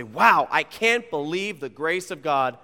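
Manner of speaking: slow, loud wonder at grace; drawn-out, emphatic astonishment; amazed. Acted. Preacher voicing a believer looking back, amazed.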